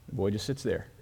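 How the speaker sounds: ordinary delivery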